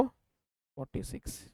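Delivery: whispered slowly to himself. Real, not acted. Teacher checking the answer on his calculator, whispered. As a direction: murmur the number under your breath.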